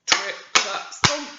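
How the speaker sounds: ordinary delivery, nothing marked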